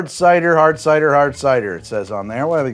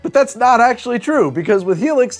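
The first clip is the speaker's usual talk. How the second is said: higher, energetic pitchman delivery; sponsor ad read; 3 semitones higher, 5 dB louder than usual. Acted. Host pitches sponsor's mattresses to viewers, punchy ad read. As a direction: sell it brightly and emphatically to the viewers.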